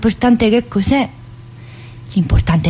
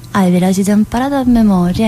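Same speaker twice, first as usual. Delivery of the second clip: normal talking voice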